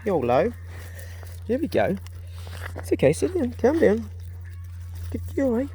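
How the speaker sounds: high, sing-song coaxing of a shy dog. Real, not acted. A man coaxing a shy whippet to come, high sing-song. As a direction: coax her gently, pitch way up.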